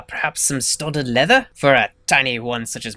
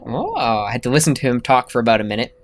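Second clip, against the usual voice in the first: ordinary talk, nothing marked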